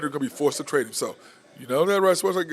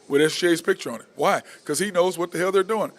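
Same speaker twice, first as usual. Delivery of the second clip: ordinary talk, nothing marked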